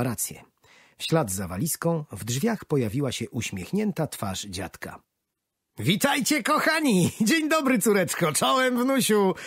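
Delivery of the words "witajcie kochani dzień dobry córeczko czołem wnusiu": joyful exclamation. Acted. Grandpa greets his daughter and grandson, joyfully exclaiming. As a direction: call out happily to family. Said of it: "wykrzyknął starszy pan".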